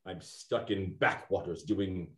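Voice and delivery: a sulking villain after a sigh; self-pity. Acted. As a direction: sulk about his fate.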